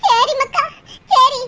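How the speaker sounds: ordinary delivery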